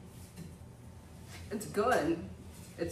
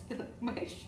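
ordinary talk

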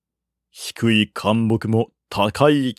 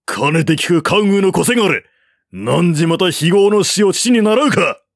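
higher, louder enraged taunt; furious challenge; 6 semitones higher, 3 dB louder than usual. A narrator's reading. General enraged at Guan's son, furious shout. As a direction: roar the insult at the enemy's son. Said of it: "長江は憤怒して迎えた".

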